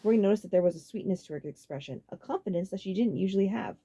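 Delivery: plain reading voice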